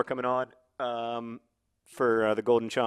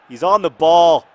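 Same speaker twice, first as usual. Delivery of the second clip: louder and slower, building commentator excitement; rising match excitement, a lot louder; excited; 6 semitones higher, 14 dB louder than usual. Real, not acted. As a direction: call it out as the chance opens. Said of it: "so excited".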